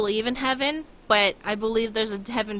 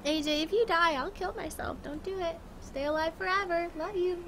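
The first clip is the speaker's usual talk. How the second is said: pet talk; 7 semitones higher, 6 dB quieter than usual. Real, not acted. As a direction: coo it like talking to a puppy. Cooing to her dog.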